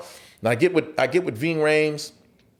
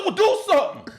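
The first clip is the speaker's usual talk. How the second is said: insistent dare; 13 semitones higher than usual. Retold or imitated. Repeated dare in retold standoff.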